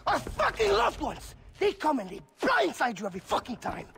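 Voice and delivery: high, loud ranting outburst; furious rant. Acted. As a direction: erupt into a manic rant about family. Vaas ranting about family.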